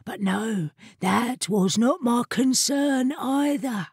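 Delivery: slow, drawn-out shadowy character; shadowed voice. A narrator's reading. Hidden chair dismisses the matter to the familiar, slow and shadowy. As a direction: draw it out as an aloof talking chair. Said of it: "agreed the voice".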